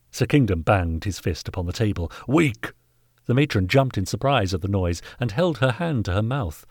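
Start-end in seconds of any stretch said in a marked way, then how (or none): 2.3-2.7 higher, punched single word; angry outburst. Acted. Knight banging the table at the master, angry. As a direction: spit it out in contempt.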